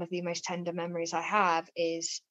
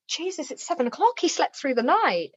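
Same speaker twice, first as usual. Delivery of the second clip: startled; 9 semitones higher, 5 dB louder than usual. Retold or imitated. Waking shocked that baby slept.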